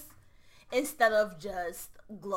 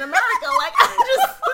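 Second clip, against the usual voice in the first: laughing; 13 dB louder than usual. Real, not acted. Laughing while explaining identity.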